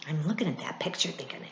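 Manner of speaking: hushed fast whisper. Real, not acted. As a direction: lean in and whisper it.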